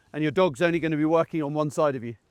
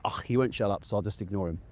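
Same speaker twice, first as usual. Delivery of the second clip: lower, softer bored dog voice; a fed-up dog tuning him out; dog's inner voice; 9 semitones lower, 7 dB quieter than usual. Retold or imitated. The dog ignoring her chatty handler, lower and softer. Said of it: "she's like".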